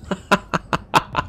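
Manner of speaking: high, voiceless staccato laugh. Real, not acted. Gamer missing by one, staccato laugh. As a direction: laugh in short separate bursts.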